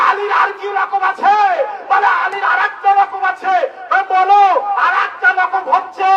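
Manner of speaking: high shouted sermon chant, building; fiery preaching. Acted. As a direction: shout the revelation to the crowd. Preaching climax on Yadullah.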